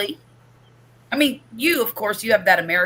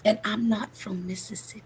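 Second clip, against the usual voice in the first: whisper; 8 dB quieter than usual. Acted. Stage-whispered aside.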